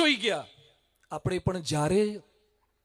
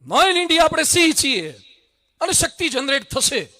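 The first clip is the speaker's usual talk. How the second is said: loud, raised rallying call; rallying cry; 4 semitones higher, 6 dB louder than usual. Real, not acted. The speaker rallies the Patidar crowd, loud and high. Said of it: "rallying the crowd".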